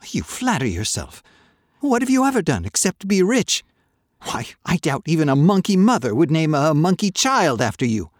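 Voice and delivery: put-on boy lashes out at the merchant; losing his temper. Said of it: "Johnny's temper began to go".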